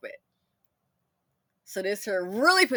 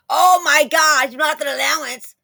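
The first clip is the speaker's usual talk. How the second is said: mock outburst; 6 semitones higher, 12 dB louder than usual. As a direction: pretend to blow up at the kid. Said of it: "I didn't get mad and go".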